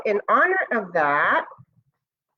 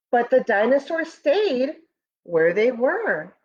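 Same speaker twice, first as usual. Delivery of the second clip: storybook narration; 3 semitones higher than usual. Acted. Teacher reading Moon's First Friends to students, storybook voice. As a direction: read it like a bedtime story for the class. Reads picture book aloud.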